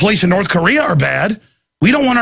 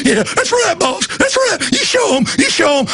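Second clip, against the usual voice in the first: put-on very high, eager Southern sidekick accent; hammed-up Southern deputy agreeing; Enos voice; 11 semitones higher than usual. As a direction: squeak back like a yes-man deputy. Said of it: "Ain't that right, Enos?".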